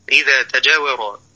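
ordinary talk, nothing marked

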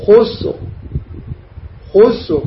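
"خسر" with U after KH and a thick vowelless R; heavy final R. Shown as a demonstration. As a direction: model "خسر" carefully, ending on heavy R. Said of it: "هكذا". ("خسر" براء ساكنة مفخمة)

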